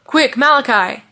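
pressing call for help; urgent. A narrator's reading. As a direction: hurry him into action. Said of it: "The red-haired man's words sounded urgent".

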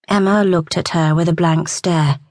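ordinary delivery, nothing marked

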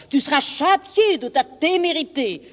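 high-pitched, angry threat; raging animal, pitch jumping up; enraged threat. Acted. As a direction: threaten punishment like an enraged beast. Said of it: "dit cet animal plein de rage".

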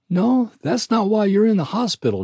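relieved country nurse; kindly nurse voice. A narrator's reading.

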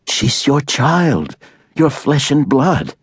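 put-on young woman appealing to a brutal father; Allie appealing. A narrator's reading. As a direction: lay each word down slowly to move him.

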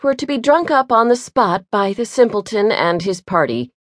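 ordinary delivery, nothing marked

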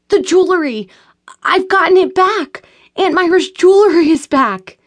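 high, loud gushing joy; breathless excited shouting; excited yelling. A narrator's reading. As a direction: blurt out the good news. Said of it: "Why are you yelling like this?".

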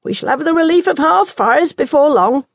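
nun voice. Acted. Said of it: "Anne said".

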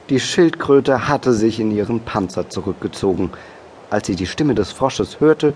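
ordinary delivery

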